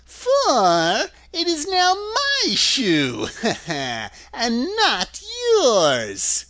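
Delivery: high, drawn-out witch voice with a cackle; wicked witch laughing as she taunts; gloating witch. A narrator's reading. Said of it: "retorted the witch".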